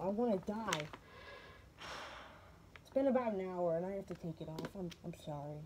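muffled behind a mask; behind welding mask. Real, not acted. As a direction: speak muffled through a mask. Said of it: "trying to game with this mask on".

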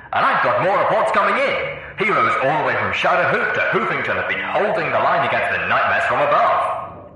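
loud, excited DJ voice on air; excited radio broadcast. A narrator's reading. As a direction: announce it loud and eager like a radio host.

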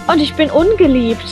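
ordinary talk, nothing marked

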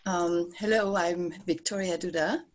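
ordinary delivery

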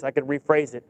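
ordinary talk, nothing marked

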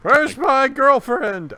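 high, loud, slow outraged yell; a jilted dandy shrieking for his girlfriend; screaming Fancy Pants. Retold or imitated. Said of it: "who's just screaming at him".

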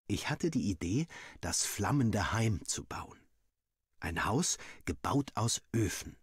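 ordinary delivery, nothing marked

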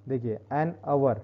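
saying "an hour" as "an aʋə", no h sound; teacher drops the h in "hour"; an hour with silent h. Shown as a demonstration. Teacher showing students "an hour" said without h. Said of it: "pronunciation हावर नहीं किया मैंने".

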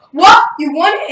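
loud, high retold disbelief; astonished. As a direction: burst out in amazed questions as the dad. Retells dad's astonished reaction.

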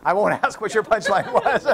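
talking through real laughter; laughing. Real, not acted. Lecturer teasing a student about the joke, laughing. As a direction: laugh along while saying it.

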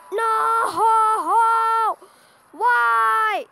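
high, loud, drawn-out wail; anguished cry. Real, not acted. Player protesting a bad moment in the game, anguished cry. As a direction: cry out in real dismay.

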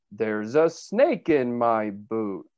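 slow, drawn-out cartoon cowboy catchphrase; Woody impression. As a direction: imitate a toy cowboy, stretching the words out. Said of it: "That's Woody from Toy Story".

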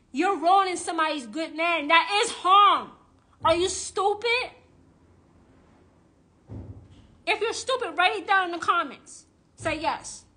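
loud, high scolding; heated rant. Real, not acted. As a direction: scold the viewers hard.

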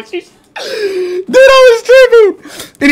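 high, slow, cracking laughing cry; squealing laugh-yell. Real, not acted. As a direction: shriek through the laughter. Laughing at his own panic.

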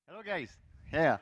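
normal talking voice

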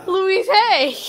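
amused exclamation. Real, not acted. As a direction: burst out with the name. Laughing realization.

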